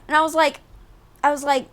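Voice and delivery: ordinary talk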